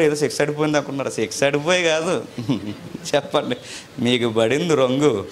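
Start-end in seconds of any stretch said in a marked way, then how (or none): 2.3-3.1 short laugh. Real, not acted. Aside about the misunderstanding.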